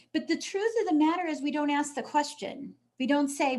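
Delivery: ordinary talk, nothing marked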